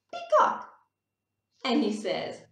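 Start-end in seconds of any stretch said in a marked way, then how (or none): 0.1-0.7 squealed high peacock; excited high cry; high peacock call. Acted. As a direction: shriek the word like a bird. Reading the animal name.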